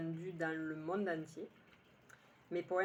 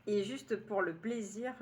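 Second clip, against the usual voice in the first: usual speaking voice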